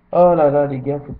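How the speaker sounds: loud exasperated sigh of words. Real, not acted. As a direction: throw up your hands, loud. Exasperated exclamation.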